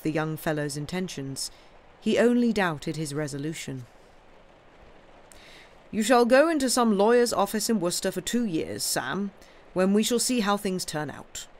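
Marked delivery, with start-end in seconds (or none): 5.9-11.5 higher, lightly voiced uncle, decided; firm uncle. Uncle deciding Sam's future, firm. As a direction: lay down the terms. Uncle gives his decision.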